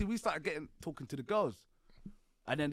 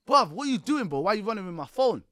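retold yell; 3 semitones higher, 6 dB louder than usual. Shouting at his mate who ran off with his phone, loud and quick. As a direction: shout after him, half laughing and confused.

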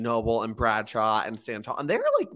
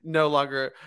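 ordinary delivery

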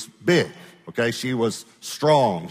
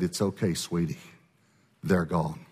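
tender comfort; 5 semitones lower, 6 dB quieter than usual. Retold or imitated. Old lady comforting freed woman.

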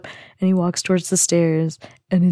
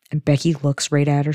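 usual speaking voice

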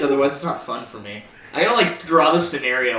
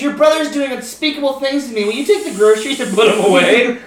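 much higher, hammed-up damsel calling for James; mock woman's plea; 8 semitones higher than usual. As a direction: squeal for James like a trapped woman in a cheesy video. Friend voices woman in porn meme.

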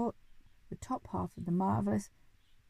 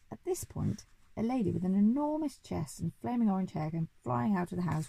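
plain reading voice

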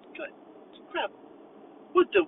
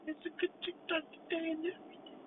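ordinary talk

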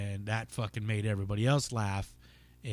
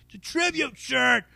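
higher mock-indignant heckler; mimicking the heckler; 12 semitones higher, 4 dB louder than usual. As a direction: play the defensive guy insisting on his shirt. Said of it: "he's like".

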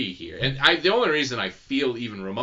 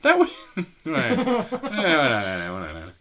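slow, helpless laugh breaking up the words; laughing through words; 4 semitones higher, 4 dB louder, much slower than usual. Real, not acted. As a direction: dissolve into laughter mid-sentence. Laughing at teasing about his looks.

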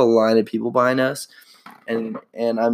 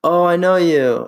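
higher and louder, thrilled to spot him; happy startled fan; excited recognition; 7 semitones higher, 5 dB louder than usual. Retold or imitated. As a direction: call out like you spotted a friend. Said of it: "It was just like".

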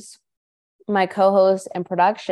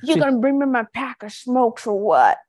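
higher, put-on smoker-woman voice; heavy smoker; 4 semitones higher than usual. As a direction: demand it like an older chain-smoker. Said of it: "So she sounded like this".

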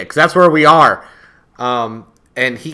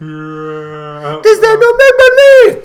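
silly high voice; 10 dB louder, much slower than usual. Pretend. High put-on voice.

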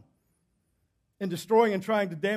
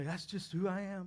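mock excuse; 13 dB quieter than usual. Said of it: "when people say".

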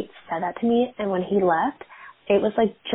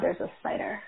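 breathless unvoiced whisper, much softer; startled whisper; 10 dB quieter than usual. Real, not acted. Noticing a spider on the ceiling mid-story, whispered. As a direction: hush it out as if not to disturb the spider.

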